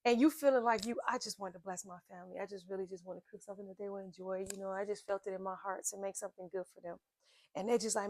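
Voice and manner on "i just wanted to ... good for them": softer, gentle inner voice of a loving mother; tender and soft. Retold or imitated. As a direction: say it softly from the heart. Mother voicing her loving intent.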